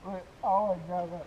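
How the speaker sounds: loud, slowed excited exclamation. Real, not acted. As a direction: shout in sudden excitement. Fish jumping from hand.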